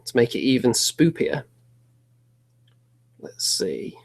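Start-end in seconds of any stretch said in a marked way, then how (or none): 3.2-4.0 soft spooky whisper. Acted. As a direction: hush it in the dark. Whispered aside in the dark.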